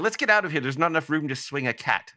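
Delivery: bad, hammed-up English accent, brisk. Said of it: "I do a bad [name] impression".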